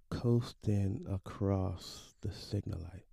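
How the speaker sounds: slow, softened, drawn-out words; slow coasting. Retold or imitated. Father's car drifting through the light, slow coasting. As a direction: let it glide along slowly and quietly.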